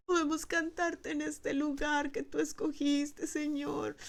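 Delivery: high, crying prayer, genuinely moved; tearful praise. Acted. Pastor voicing weeping elders back in the temple, tearful praise. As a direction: plead to God through tears. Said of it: "ellos están alabando y lloran y lloran".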